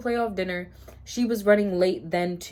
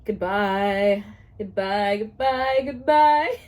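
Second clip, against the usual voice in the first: overwhelmed farewells; 5 dB louder, much slower than usual. Real, not acted. Reader bowled over by romance, escalating farewells. Swooning sign-off after romantic line.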